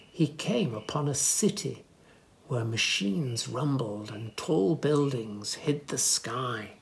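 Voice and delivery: ordinary delivery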